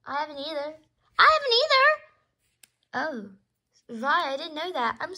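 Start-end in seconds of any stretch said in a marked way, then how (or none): 1.2-3.3 much higher, louder and slower; big, high, loud repeat; high drawn-out exclamation. Pretend. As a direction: squeal it out, stretching the words. Same line repeated, far bigger.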